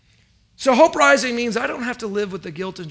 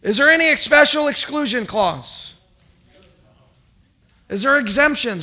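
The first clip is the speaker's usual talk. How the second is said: higher, louder questioning; mock the idea of exceptions; rhetorical challenge; 5 semitones higher, 6 dB louder than usual. Pastor challenging congregation about exclusions, rhetorical preaching.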